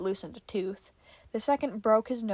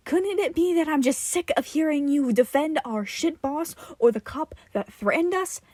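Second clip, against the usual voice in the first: angry outburst; 5 semitones higher, 5 dB louder than usual. Acted.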